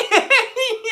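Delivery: sudden high-pitched laugh; high burst of laughter. Real, not acted. Laughing at a friend's joke.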